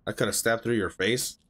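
low, subdued reading voice; subtitle read-aloud. A narrator's reading. Viewer reading anime dialogue to himself, subdued read-aloud.

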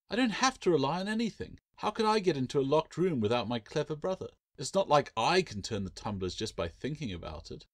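put-on high, protesting girl; mock innocence. A narrator's reading. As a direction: tease the brother with false helplessness. Sister teasing her brother.

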